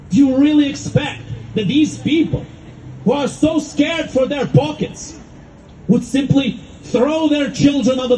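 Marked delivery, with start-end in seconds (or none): none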